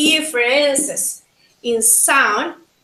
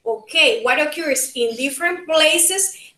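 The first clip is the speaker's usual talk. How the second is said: ordinary delivery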